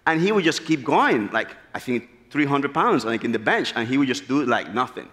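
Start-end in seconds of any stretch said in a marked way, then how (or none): none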